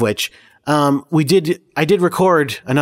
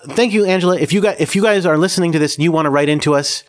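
ordinary talk, nothing marked

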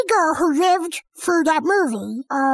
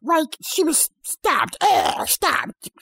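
usual speaking voice

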